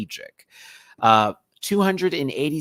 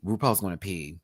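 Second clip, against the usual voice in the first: normal talking voice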